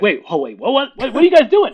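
rising to a high, loud what; a panicked dad in a shop; flustered. Retold or imitated. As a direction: stammer in growing alarm. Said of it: "I'm like".

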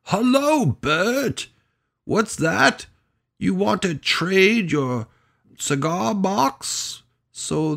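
very high, loud, drawn-out puppet-show storekeeper; hammed-up high children's TV character voice; slow, high-pitched greeting to puppet pals; Mister Hooper. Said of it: "Mister Whooper on Sesame Street".

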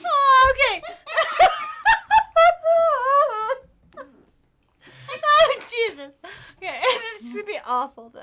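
loud high laughing; trying to stop laughing; laughing hard. Real, not acted.

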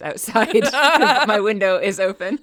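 talking through laughter; laughing. Real, not acted. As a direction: giggle through the words. Laughing about her puppy outside.

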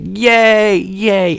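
high, drawn-out mock cheering; put-on gleeful squeal of celebration; imitated childlike cheer. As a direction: mimic simple delight way up high. Imitates neighbor delighted with bubbles.

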